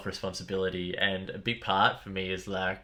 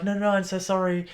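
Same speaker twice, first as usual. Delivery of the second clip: apologetic; 11 semitones higher, faster than usual. Retold or imitated. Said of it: "They're like".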